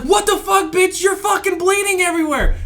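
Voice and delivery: high, incredulous outburst; shocked shout. Retold or imitated. Marine shouting at bleeding girl on bed. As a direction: yell at her in disbelief. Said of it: "I was like".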